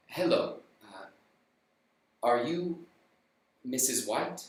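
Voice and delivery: low, soft grave messenger; lightly voiced solemn visitor; solemn stranger. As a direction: stranger bringing bad news.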